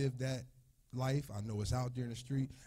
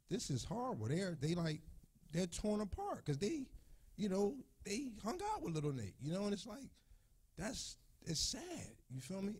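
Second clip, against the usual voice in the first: fighting back tears, tight and strained; choked-up grief; emotional, voice breaking; 10 semitones higher than usual. Real, not acted. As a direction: voice cracking high with grief. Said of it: "It's making me emotional".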